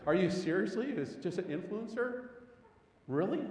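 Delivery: high, disbelieving rhetorical questions; incredulous. Acted. Pastor challenging whoever calls Jesus an influencer, incredulous.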